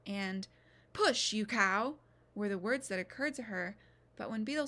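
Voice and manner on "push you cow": harsh command. Retold or imitated. Midwife's harsh command, recalled.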